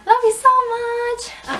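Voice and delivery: high, drawn-out affection; playful singsong goodbye; cutesy sign-off. Pretend. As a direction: coo it to your viewers.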